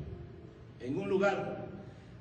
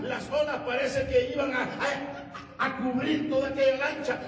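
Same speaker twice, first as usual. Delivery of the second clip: raised, driving sermon narration; preaching; 7 semitones higher than usual. Acted. Preacher telling the congregation of the storm at sea, urgent preaching. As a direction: drive the storm story along in bursts.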